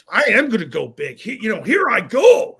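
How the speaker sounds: a mild mock pumped-up vow; fired up. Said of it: "you get fired up".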